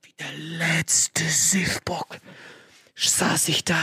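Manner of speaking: very slow storyteller's whisper; dramatic whisper. Real, not acted. Storyteller recalling his humiliation in the kiosk, whispered slowly. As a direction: drop to a drawn-out whisper.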